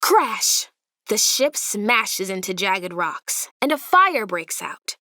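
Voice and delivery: ordinary delivery, nothing marked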